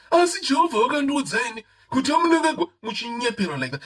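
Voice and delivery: high, loud, whining mock prophecy; mocking the self-proclaimed prophet. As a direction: parody the false apostle proclaiming God's word. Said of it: "this person comes and tells you".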